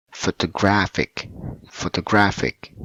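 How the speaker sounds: "photographic" with a strong beat on "graph"; stress on third syllable. Shown as a demonstration. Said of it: "stress word on the third syllable".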